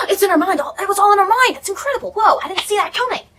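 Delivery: high gasping fake astonishment; mock amazement. Viewer mocking the twist ending in a high gasp. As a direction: ham up the shock of a twist.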